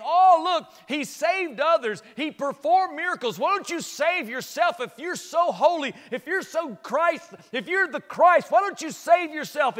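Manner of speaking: loud, high, mocking jeer; jeering crowd mocking Jesus; taunting mockers. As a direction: sneer it out like the crowd at the cross. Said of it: "where they're taunting him, saying".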